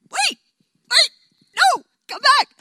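high, loud call to stop them running; mock-urgent shout of come back; calling after them. Moses calling after the people fleeing the cloud, loud and high.

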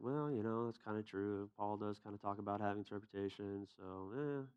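hesitant mumble. Retold or imitated. Unsure friend conceding a point.

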